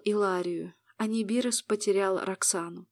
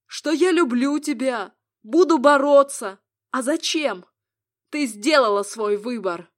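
bitter hurt husband; 3 semitones higher, 7 dB louder than usual. Acted. Lovers' quarrel, man's voice.

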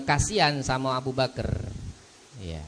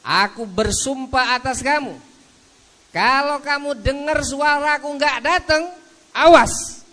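stern father threat; 13 semitones higher, 7 dB louder than usual. Acted. Father threatens his son.

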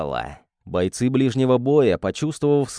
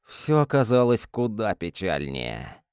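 ordinary delivery, nothing marked

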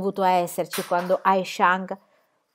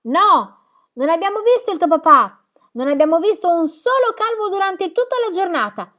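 chiamata forte e acuta; grido lontano; 9 semitones higher, 6 dB louder than usual. Acted. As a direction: gridale dietro mentre si allontana. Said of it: "Poi gridò dietro la bambina". (Pippi che grida alla bambina che se ne va)

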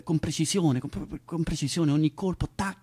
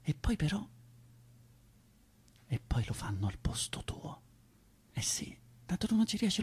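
bitter whisper; 3 semitones higher, 7 dB quieter than usual. Acted. Bitter hushed aside.

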